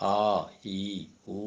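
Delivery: "ആ, ഈ, ഊ" as separate open vowels; unobstructed vowel sounds. Shown as a demonstration. Teacher showing vowels with no obstruction, "ആ, ഈ, ഊ" sounded separately. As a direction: sound out "ആ, ഈ, ഊ" one by one, airflow unblocked. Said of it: "vowels ആണെങ്കിൽ ഉച്ചരിക്കുമ്പോൾ തടസ്സമില്ല".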